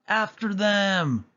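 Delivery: high, slow barked order; villain shouting at her henchmen. A narrator's reading. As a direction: command the chase. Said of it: "Cruella shouted".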